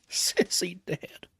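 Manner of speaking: hushed whisper, stunned and empty; vacant whisper. A narrator's reading. Father hearing his daughter died, vacant whisper. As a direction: breathe it out, numb with shock. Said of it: "he said vacantly".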